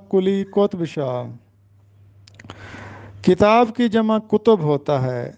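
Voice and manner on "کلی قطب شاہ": قطب said as "qotb", no vowel between t and b; کلی قطب شاہ spoken "kuli qotb shah"; careful teacher's model of کلی قطب شاہ; correct pronunciation. Shown as a demonstration. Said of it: "تو درست تلفظ ہوگا".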